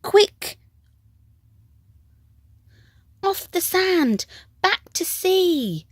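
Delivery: very high, loud urgent cry. A narrator's reading. Snail urging the beached whale back to sea, high alarmed cry. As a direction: shout in alarm to save him. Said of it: "cried the snail".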